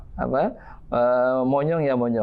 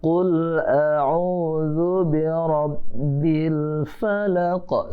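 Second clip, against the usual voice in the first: slow drawn-out Quran chant, higher; Quran recitation; 5 semitones higher, much slower than usual. Shown as a demonstration. Teacher chanting a verse for children to repeat, slow and drawn out. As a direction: chant each word slowly for the class to copy.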